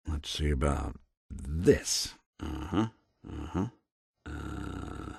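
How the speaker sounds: low, soft thinking noises; absorbed pondering. Acted. Investigator puzzling over a terminal, quietly pondering. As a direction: think under your breath, low and quiet.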